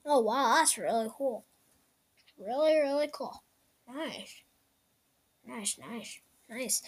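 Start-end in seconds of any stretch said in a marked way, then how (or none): none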